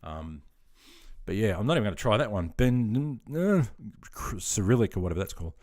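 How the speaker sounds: ordinary talk, nothing marked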